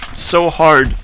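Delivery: ordinary talk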